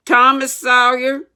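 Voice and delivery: loud, high schoolmaster bellow; angry teacher shouting a name; stern shout. Acted. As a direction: call the boy out sharply. Schoolmaster calls out angrily.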